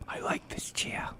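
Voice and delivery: slow, soft whisper; mock Walken whispering about the chair. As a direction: whisper it slowly and approvingly. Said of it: "You gotta get the whisper in there".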